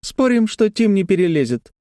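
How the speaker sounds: put-on high, teasing child voice; taunting little girl. A narrator's reading. Sister bets her brother can't climb, high teasing voice. Лекси дразнит брата.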